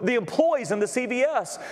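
high-pitched, driving sermon storytelling; impassioned preaching. Retold or imitated. Pastor retelling a church lady's burden to the congregation, impassioned preaching. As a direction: preach her words with urgent fervour.

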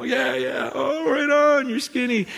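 high, amused mock cheering; cheering friends. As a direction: play along like a laughing crowd. Laughing friends cheer her on.

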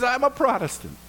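high delighted repeat; excited echo. Retold or imitated. Rescuer squealing back in delight at a fellow Protestant.

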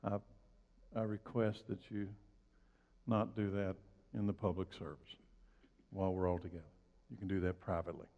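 low, soft voice with careful pauses; composed, hushed and unhurried; very calm request. Pretend. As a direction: gently ask them to stop, unruffled. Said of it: "very calmly say".

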